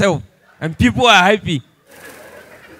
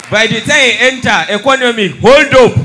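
from raised voice up to a full shout, higher; comedian's climbing punchline yell; building comic shout; 5 semitones higher, 7 dB louder than usual. Acted. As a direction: rant it louder and louder to the crowd.

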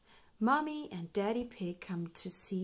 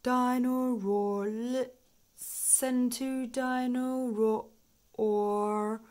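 slow, dragging toy voice running out; winding down; 5 semitones higher, 7 dB louder, much slower than usual. A narrator's reading. Dino Roar's song slows as the batteries die. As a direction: let the toy's song wind down.

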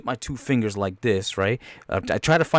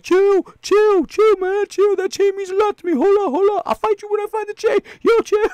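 very high squealed falsetto; hammed-up panicky begging; mock pleading; 4 dB louder than usual. Beaten fighter begs for time to find his chain, squealed pleading.

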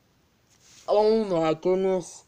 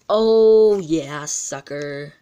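triumphant shout; 2 semitones lower, 7 dB louder, much slower than usual. Real, not acted. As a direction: shout it out in victory. Reacting to winning coin flip.

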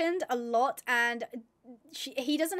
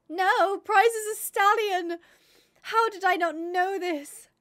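higher, louder cry of dismay; dismayed outcry; 6 semitones higher, 6 dB louder, slower than usual. Real, not acted. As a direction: wail it out in drawn-out disbelief. Discovers Prize is a stallion.

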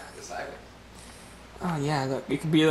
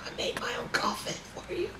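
dying whisper; 10 semitones higher, 13 dB quieter than usual. Acted. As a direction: breathe out last words. Continued death scene.

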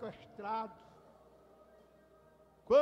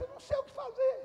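put-on crying child; 10 semitones higher, faster than usual. Criança chorando responde aos pais.

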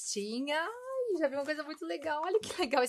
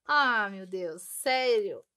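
thrilled exclamation; 3 semitones lower, 7 dB louder, slower than usual. Real, not acted. Favourite sweets included.

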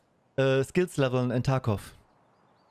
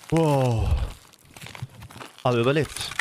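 usual speaking voice

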